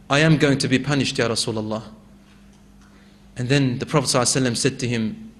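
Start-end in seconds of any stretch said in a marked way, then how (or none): 0.1-1.8 grief-stricken. Acted. Crying man fears punishment.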